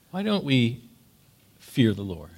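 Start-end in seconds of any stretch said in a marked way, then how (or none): none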